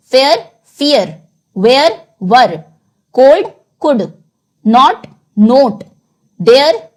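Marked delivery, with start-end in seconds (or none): 1.6-2.6 slow "वेयर" with a long vowel, then a short "वर"; contrasting where and were. Shown as a demonstration. Teacher reviewing different-sounding pairs, slow and careful. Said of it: "पहले different sound के words". 4.7-5.8 slow open "नॉट", then rounded "नोट"; contrasting knot and note. Shown as a demonstration. Teacher reviewing "नॉट" and "नोट" for students, slow. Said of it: "पहले different sound के words".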